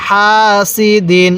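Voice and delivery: measured recitation with a long হা; elongation where marked. Shown as a demonstration. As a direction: draw out হা evenly before সি দিন. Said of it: "যেখানে টান দিতেছি সেখানেই টান". (হা টেনে, তারপর সি দিন পড়া)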